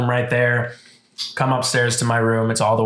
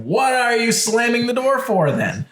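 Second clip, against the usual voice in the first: higher incredulous challenge; 10 semitones higher than usual. Retold or imitated. Telling the repairman off for slamming, higher in disbelief.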